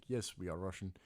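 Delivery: low, soft, terse reply; imitated Russian patron. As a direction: play a reserved Russian answering briefly. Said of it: "uh, Russian?".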